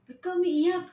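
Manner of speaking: concerned surprise. Retold or imitated. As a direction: friend surprised to see her crying. Friend finds her crying. (gulat at nag-aalalang tanong)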